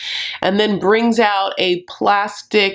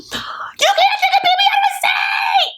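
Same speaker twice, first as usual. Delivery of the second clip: screeching high imitation of a furious mom; hammed-up shriek of outrage; mock rage. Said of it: "she goes into a Cheeto rage".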